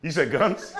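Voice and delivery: normal talking voice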